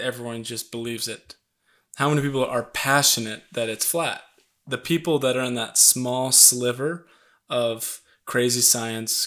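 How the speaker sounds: ordinary talk, nothing marked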